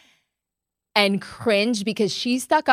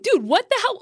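shocked disbelief; 10 semitones higher, faster than usual. Retold or imitated. Reacting to the sunglasses scene.

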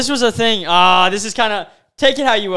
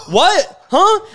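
mock affronted girl, pitched up; offended; 9 semitones higher, 3 dB louder than usual. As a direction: imitate an indignant, high questioning reaction. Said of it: "they get all offended".

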